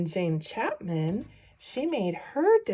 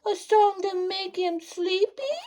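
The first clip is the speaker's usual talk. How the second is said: little wren voice; 10 semitones higher than usual. Acted. Wren wondering what to try.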